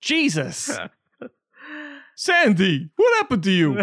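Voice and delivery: loud, high appalled outcry; mock shock. Boyfriend confronts a transformed Sandy, hammed-up shock. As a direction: cry out as if scandalised.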